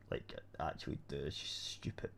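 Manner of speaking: hushed aside, breath only; whisper. Real, not acted. Friend whispering an insult about the MP, whispered. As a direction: drop to a whisper to badmouth her. Badmouthing the MP quietly.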